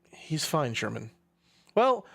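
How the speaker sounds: ordinary delivery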